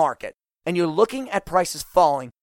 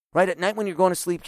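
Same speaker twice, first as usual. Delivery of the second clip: normal talking voice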